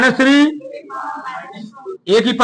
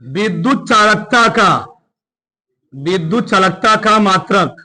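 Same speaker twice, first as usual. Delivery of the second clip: slow, measured dictation with long pauses. Real, not acted. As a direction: dictate slowly, phrase by phrase. Teacher dictates heading for note-taking. (धीरे-धीरे रुक-रुककर लिखवाते हुए)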